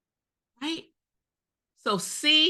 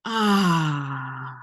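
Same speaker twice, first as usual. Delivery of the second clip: low, drawn-out sighed ah; audible ah sound; 6 semitones lower than usual. Shown as a demonstration. Guiding a room to let out sound, a low drawn-out ah. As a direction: release it deeply from the body. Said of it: "let out an audible".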